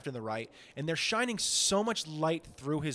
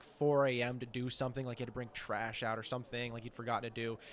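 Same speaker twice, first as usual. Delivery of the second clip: ordinary talk, nothing marked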